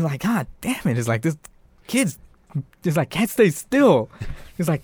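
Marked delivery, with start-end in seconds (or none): 0.2-1.0 high-pitched exasperated curse; mock frustration. Chucky missing stabs under bed, mock frustrated. 1.2-2.2 high-pitched fed-up grumble; mock exasperation. Chucky thwarted under the bed, mock exasperated. Chucky voiced missing his stabs. 3.1-4.1 high, drawn-out frustrated complaint; mock exasperation. Chucky stabbing at moving targets, mock exasperated. Chucky voiced missing his stabs.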